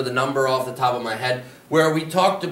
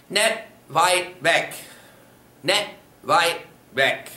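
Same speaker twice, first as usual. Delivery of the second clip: slow drill repetition; 2 semitones higher, slower than usual. Shown as a demonstration. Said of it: "Not far away".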